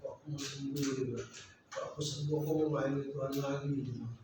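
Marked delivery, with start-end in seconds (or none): 0.0-0.8 low, hushed, sorrowful quote; a weak patient's plea, gently imitated; retold tearful plea. Preacher retells addicted friend crying to him, soft tearful plea. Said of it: "dia nangis". 1.7-3.7 soft, low, subdued pleading; retold tearful plea. Preacher retells sick friend longing to serve, understated tearful plea. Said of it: "dia nangis".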